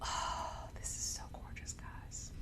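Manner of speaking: hushed, breathy admiration; whispering. Real, not acted. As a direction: whisper it in awe. Admiring the piece up close.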